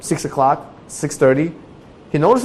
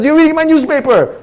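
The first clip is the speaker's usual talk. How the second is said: high, louder outraged charge; angry accusation; 14 semitones higher, 5 dB louder than usual. Retold or imitated. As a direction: accuse the neighbor. Quarrel with neighbor.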